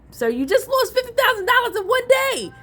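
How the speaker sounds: high, shocked exclamation; shrill-ish stunned scold; incredulous outburst. Acted. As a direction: disbelief that anyone could lose that much. Outraged at reckless gambler.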